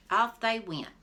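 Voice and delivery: plain reading voice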